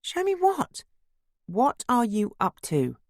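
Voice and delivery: high, curious questioning; intrigued surprise. Acted. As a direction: ask high, then draw out the suspicion. Wife questions husband's surprise.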